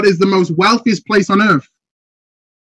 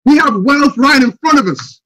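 normal talking voice

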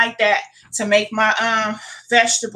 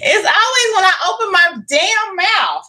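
exasperated outburst; 11 semitones higher, 4 dB louder than usual. Real, not acted. Eyes tearing up while talking.

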